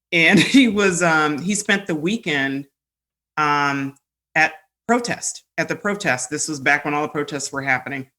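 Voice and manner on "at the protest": normal talking voice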